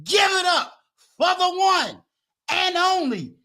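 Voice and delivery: big high-pitched MC build-up, stretching key words; hype announcer introduction. Acted. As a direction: bring the guest on stage like a showman.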